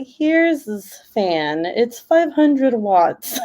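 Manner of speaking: drawn-out mock sales pitch; slow teasing. Teasing her sister over fan sales, slow mock presentation.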